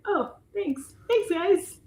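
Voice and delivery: very high, sing-song fake gratitude; sarcastic thanks. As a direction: thank them with mock sweetness.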